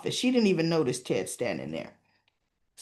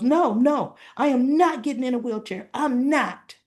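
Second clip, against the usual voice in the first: higher, emphatic protest; acted stubborn refusal; 5 semitones higher, 4 dB louder than usual. Derek refusing the nurse's wheelchair, stubborn. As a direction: dig in and refuse, pitch raised.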